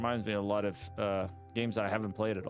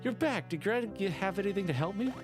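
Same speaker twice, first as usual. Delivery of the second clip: higher, cheerful shop NPC; grateful shopkeeper; 6 semitones higher than usual. Acted. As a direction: thank the hero brightly.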